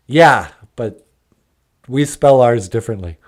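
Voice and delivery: ordinary delivery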